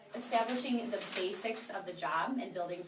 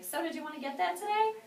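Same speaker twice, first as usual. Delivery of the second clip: high, timid asking voice, pitched up and unsure; a scared rookie's high, hesitant question; nervous ask; 8 semitones higher, 4 dB louder than usual. Retold or imitated. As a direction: ask meekly, as if afraid of a no. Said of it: "I looked scared and I was, like, nervous".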